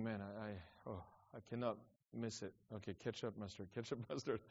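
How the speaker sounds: whisper-like self-talk under pressure; quiet inner monologue. Retold or imitated. Inner thoughts while serving.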